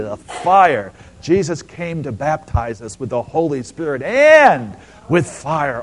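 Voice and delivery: loud, gushing over the fire words; mock church enthusiasm. Preacher mocking how the church gushes over fire words. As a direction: ham up the crowd's delight in a sweet word. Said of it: "you know I'm setting you up".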